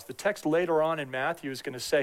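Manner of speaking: usual speaking voice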